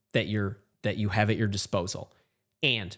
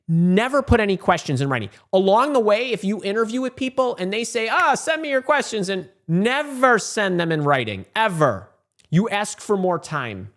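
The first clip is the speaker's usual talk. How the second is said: insistent; 8 semitones higher, 4 dB louder than usual. Real, not acted. Building insistence on the rule.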